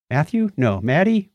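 ordinary delivery

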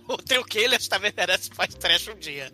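ordinary talk, nothing marked

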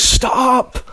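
high-pitched, drawn-out yell to halt a car; mock shouted command. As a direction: shout it like flagging down traffic. Imitates gun-wielding woman halting car.

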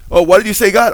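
hammed-up high, loud question to God; mock prosperity teacher. Preacher parodies a faith healer consulting God, mocking. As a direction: pretend to hear God, loudly and theatrically.

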